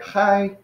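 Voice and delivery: "Hi" pitched high, lifting upwards; rising accent. Shown as a demonstration. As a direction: demonstrate an upward tone on "Hi". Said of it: "it's like lifting it up".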